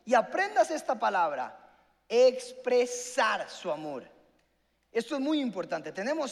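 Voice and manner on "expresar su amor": "expresar" slowly broken into syllables; emphatic key word. Real, not acted. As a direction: drill "expresar" in slowly, piece by piece. Preacher drills the key word.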